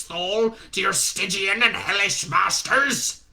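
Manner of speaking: loud, high fire-and-brimstone rant; ranting zealot. Acted. Shadwell ranting at Newton about hellish masters. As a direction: denounce him as a demon. Shadwell hears Lucifer, rants.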